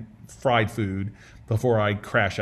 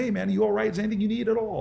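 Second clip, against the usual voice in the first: lighthearted; 9 semitones higher, much faster than usual. Retold or imitated. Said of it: "I'll try to say it in a lighthearted way".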